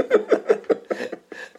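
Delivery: unvoiced high laugh; real breathy laughter; high airy laugh. Real, not acted. Laughing at the Macarena excuse, high airy laugh.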